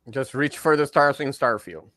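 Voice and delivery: usual speaking voice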